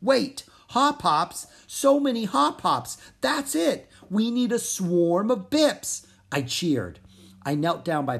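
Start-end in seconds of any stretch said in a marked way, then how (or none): 0.0-6.0 high, slow frog voice hitting on an idea; squeaky little frog having a eureka moment; excited frog. Acted. Little frog Pip realizing the answer, excited and high.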